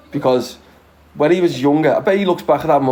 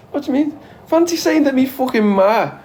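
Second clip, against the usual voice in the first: mock outrage; 12 semitones higher than usual. Ribbing a friend's dad on a live stream, high mock outrage. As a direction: act scandalised, pitch way up.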